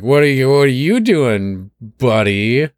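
a hint of a drunken slur; lightly slurred drunk greeting; fake drunken slur. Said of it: "you fake drunkenly slur at him".

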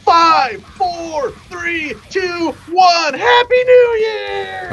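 high shouted chant, slow and drawn out; imitated crowd countdown. People counting down at midnight during a fight, imitated crowd shout. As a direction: mimic a party crowd roaring in the new year.

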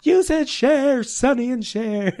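wife teasing husband's pronunciation, slow and mocking. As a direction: drag it out to tease him. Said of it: "my wife, like".

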